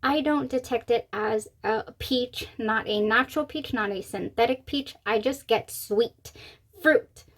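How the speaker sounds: ordinary delivery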